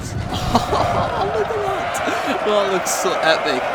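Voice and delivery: squealing laugh that breaks into delighted awe. Real, not acted. Gamer laughing at an epic cavalry charge on screen, delighted.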